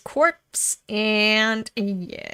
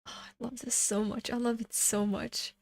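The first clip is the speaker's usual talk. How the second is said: much softer, hushed delight; soft adoration; 10 dB quieter than usual. Real, not acted. Reader softly adoring the artwork. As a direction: breathe your love for it.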